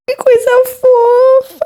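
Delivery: high, slow delighted squeal. Real, not acted. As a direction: coo over something adorable. Squealing over cute photo.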